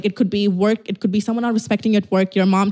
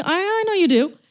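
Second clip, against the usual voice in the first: usual speaking voice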